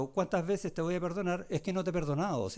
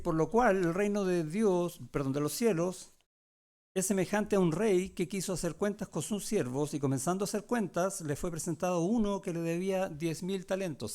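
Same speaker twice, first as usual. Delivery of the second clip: slow, raised reading voice from the Gospel; scripture reading; 3 semitones higher, 4 dB louder than usual. Acted. Pastor reads Matthew's parable to the congregation, a raised, deliberate reading. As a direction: read the verses out to the flock.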